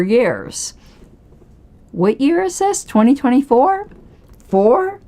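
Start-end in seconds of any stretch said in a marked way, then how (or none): 1.9-5.0 mock-puzzled, much higher; sarcastic question. Real, not acted. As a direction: feign confusion to make a point. Rhetorical jab at the bylaws.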